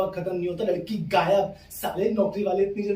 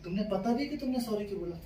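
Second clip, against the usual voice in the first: soft, restrained mimicked girlfriend; pointed questioning; 6 dB quieter than usual. Girlfriend questions boyfriend's apology, soft pointed question. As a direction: ask it softly, testing him.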